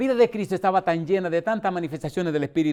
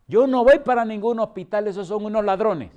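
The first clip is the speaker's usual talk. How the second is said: indignant refusal; 6 semitones higher, 8 dB louder than usual. Retold or imitated. Old woman refusing the ambulance.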